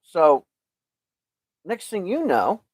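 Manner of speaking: ordinary talk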